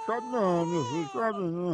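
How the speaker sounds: low, soft mock hushing; soothing a baby. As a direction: sink into a pretend lullaby-like aside. Feigns hushing a crying baby.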